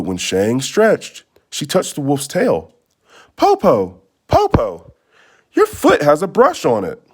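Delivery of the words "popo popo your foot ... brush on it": put-on high, startled girl's voice; a little girl noticing something odd; alarmed child. Girl tells grandmother about her foot, startled.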